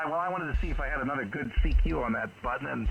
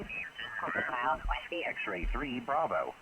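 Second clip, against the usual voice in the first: slow callsign; 6 dB quieter, much slower than usual. Real, not acted. Drawn-out radio callsign.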